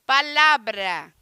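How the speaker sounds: "Palavra" loud and slow, weight on the middle syllable "la"; stress on LA. Shown as a demonstration. Teacher asking students to find the strong syllable, stressing LA in "Palavra". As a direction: punch the "la" in "Palavra", drawing it out. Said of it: "qual é a sílaba mais forte?".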